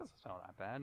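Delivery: quiet, offhand imitation of a friend; mock Nathan, mumbled. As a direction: play it down in a low murmur. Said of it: "Nathan's gonna be like".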